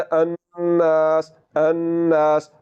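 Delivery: slow अन्नास with a nasal, held नून; nasal ghunna demo. Shown as a demonstration. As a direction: hum the नून through the nose in अन्नास. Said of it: "गुन्ना करना है".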